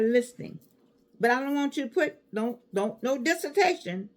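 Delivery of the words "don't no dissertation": usual speaking voice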